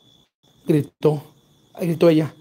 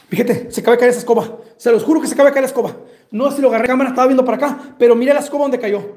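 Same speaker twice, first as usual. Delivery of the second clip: asustado y agitado; 5 semitones higher, 5 dB louder than usual. Real, not acted. Se asusta al caer la escoba.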